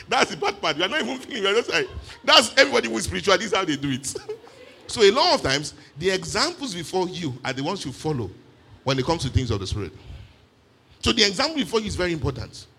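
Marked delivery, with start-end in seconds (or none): none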